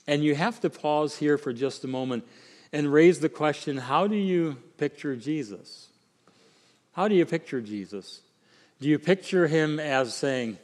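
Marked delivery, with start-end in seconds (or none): none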